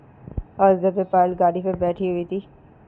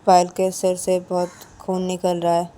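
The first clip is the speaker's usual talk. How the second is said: ordinary delivery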